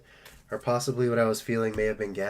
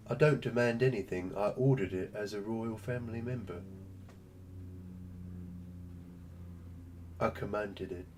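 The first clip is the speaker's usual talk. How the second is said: snooty little royal, dropping softer toward the end; haughty royal pet; 4 semitones lower, 8 dB quieter than usual. Pretend. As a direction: play the pampered pet who thinks he's royalty.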